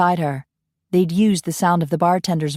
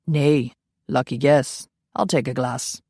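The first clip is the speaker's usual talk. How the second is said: lower, slow Scottish reply; gruff Scot; 3 semitones lower than usual. Acted. Ian ordering from Coralie, lower slow Scottish voice. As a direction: answer like a dry Highlander.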